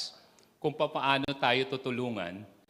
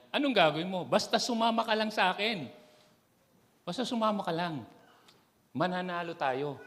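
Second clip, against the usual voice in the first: voiced story character; 8 semitones higher, 3 dB louder than usual. Parent-child dialogue in story.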